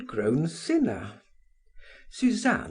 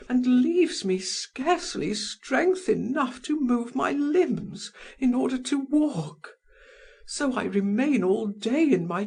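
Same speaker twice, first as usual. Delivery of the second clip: desperate lover; 13 semitones higher than usual. A narrator's reading. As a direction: pour out her suffering to him. Held Madame Walter voice.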